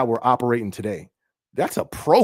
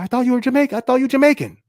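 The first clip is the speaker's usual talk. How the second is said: higher, light mock of a stranger's surprise; surprised acquaintance; 12 semitones higher, 6 dB louder, much faster than usual. Stranger who thought he was Jamaican, mildly mocked. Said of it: "They're like".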